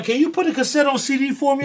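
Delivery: mock a client asking a favor; customer's request. A customer asks him to copy a cassette, lightly voiced. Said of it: "I'm like".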